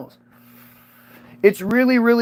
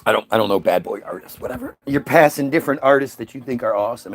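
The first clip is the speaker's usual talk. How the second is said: ordinary delivery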